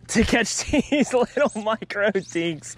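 laughing through the words. Real, not acted. Angler laughing at a tiny catch, laughing. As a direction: chuckle while calling them micro dinks.

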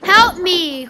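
loud call for help; crying for help. A narrator's reading. A man calling out to travellers for help. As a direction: call out from afar, desperate. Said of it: "cried a man".

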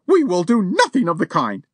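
the lady flaring up at the burglar, high and angry; blazing. A narrator's reading. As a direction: flare up at him. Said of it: "blazed Missus Champney".

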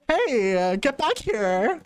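high, drawn-out call; playful high holler; calling after someone. Real, not acted. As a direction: call them back, stretched out and high. Chasing another player in game.

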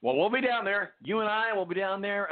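ordinary talk

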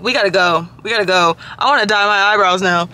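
usual speaking voice

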